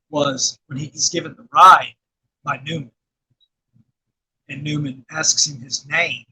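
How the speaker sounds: ordinary talk, nothing marked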